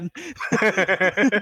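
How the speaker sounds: short burst of real laughter; amused chuckle. Real, not acted. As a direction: laugh out at the punchline. Laughing at the ban story.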